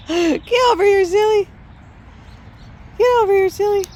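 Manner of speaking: high, loud playful call; excited dog call. Real, not acted. Calling the playing dog.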